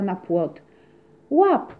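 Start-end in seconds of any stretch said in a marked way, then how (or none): 1.3-1.7 high, loud shout; cat on the fence shouts to her friend; shouted call. Acted. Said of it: "krzyknęła do łakocia".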